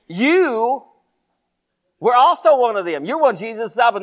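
high, loud, stretched accusation; pointed accusation. Acted. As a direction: act out an accuser calling someone out. Accuser confronts Peter in story.

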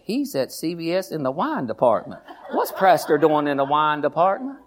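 laughing mock gossip. Imagined gossiper spotting the pastor, laughing mock gossip.

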